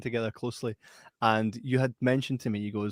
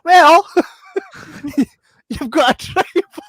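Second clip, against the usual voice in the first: high, loud, laughing squeak; mock panicked blurt with laughter; blurted through laughter; 14 dB louder, slower than usual. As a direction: cringe and laugh it out.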